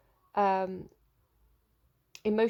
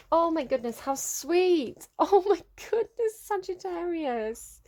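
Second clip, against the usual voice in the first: high, bright burst of delight, with a laugh; delighted squeal; 8 semitones higher, 4 dB louder, slower than usual. Real, not acted. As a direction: gush over the card like a thrilled fan. Delighted reaction to a card.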